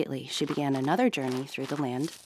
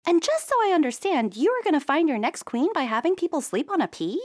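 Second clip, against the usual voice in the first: very high, loud disbelieving question; incredulous princess; 13 semitones higher, 6 dB louder than usual. A narrator's reading. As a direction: ask in disbelief. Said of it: "said the queen".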